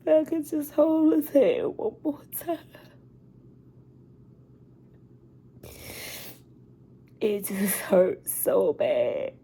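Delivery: a high, tear-choked whisper; breathing the words out through sobs; grief-stricken whisper; whispered crying. Real, not acted. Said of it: "I didn't mean to get emotional".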